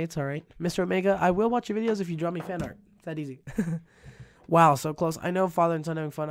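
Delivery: usual speaking voice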